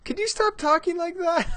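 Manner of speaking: put-on high, girlish voice of his daughter; imitating daughter. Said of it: "my poor daughter's like".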